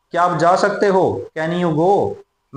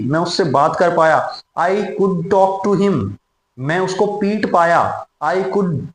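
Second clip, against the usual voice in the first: ordinary talk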